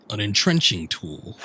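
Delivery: imitating the character's wording; mock formal item name; mimicking the game. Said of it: "Or a shovel".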